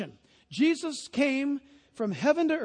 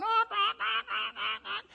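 much higher, fading honks; goose honks; 10 semitones higher, slower than usual. Retold or imitated. Pastor imitating yelling geese, high honks. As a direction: honk shrinking away.

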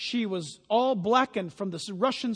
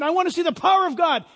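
eager churchgoer; 8 semitones higher, 10 dB louder, faster than usual. Retold or imitated. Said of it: "Some of you might be saying".

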